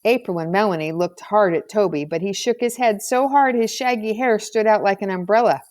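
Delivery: ordinary delivery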